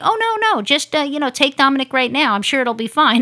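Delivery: sing-song mock of pushy rescue workers; mock rescue lady. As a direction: play the eager rescue ladies brushing them off. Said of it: "they're like".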